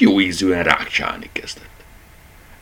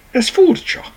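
much higher, wondering; puzzled teddy; 4 dB louder than usual. A narrator's reading. Teddy bear puzzles over the rabbit, high and wondering. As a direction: sound genuinely baffled.

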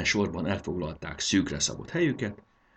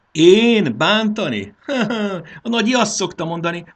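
laughing; 13 semitones higher, 8 dB louder than usual. A narrator's reading. Marci denies hurting the cow, laughing. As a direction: protest in amazement and burst out laughing. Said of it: "nevetett újra Marci".

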